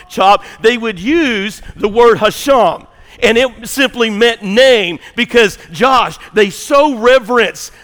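loud, high, fervent preaching voice; climbing pulpit fervour; full-force preaching. Acted. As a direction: preach it to the back pews.

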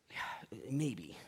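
whispered reluctant yeah maybe; grudging whisper. Acted. Doubting believer grudgingly conceding to God's promises, whispered. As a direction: breathe it out, half-conceding.

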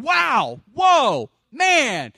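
excited shout to Ellen about how great it is; wow excitement. Shown as a demonstration. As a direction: burst out like greeting a dear friend. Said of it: "I get that wow thing going".

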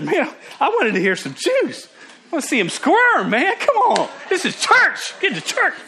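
high, squealing mock excitement; hammed-up gossip hunger. Churchgoer eager to hear others' drama, hammed-up excitement.

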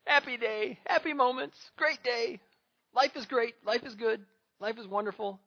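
joyful. Real, not acted. Father rejoicing about his son's love, beaming. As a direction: let the joy bubble over.

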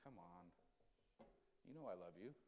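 soft, low, reassuring reply; God coaxing a reluctant believer, soft and low; tender God's voice. Acted. God coaxing in acted dialogue.

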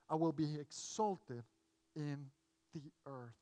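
low, soft, solemn Bible reading; scripture reading. Acted. Preacher reading a psalm to the congregation, low and solemn. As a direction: read the psalm aloud, quiet and measured.

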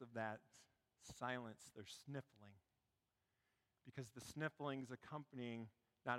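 soft, low, with long pauses; hushed storytelling. Real, not acted. Preacher telling how a theater was moved to tears, hushed. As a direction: let the silence hang between phrases.

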